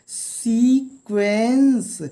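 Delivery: सीक्वेंस drawn out very slowly, part by part; broken into syllables. Shown as a demonstration. Teacher splitting a long word for learners, syllable by syllable. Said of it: "यहाँ पे देखिए दो तीन syllable हैं".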